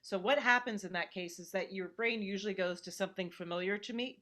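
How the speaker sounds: normal talking voice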